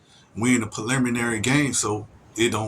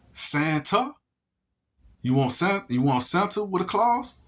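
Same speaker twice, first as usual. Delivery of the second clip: mock question; 7 semitones higher than usual. Mock question teasing listener.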